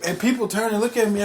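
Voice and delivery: ordinary talk, nothing marked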